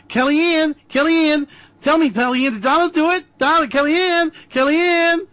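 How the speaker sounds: high, loud, insistent calling. Acted. Host calling to Kellyanne like a reporter, high insistent calls. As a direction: shout her name like a reporter.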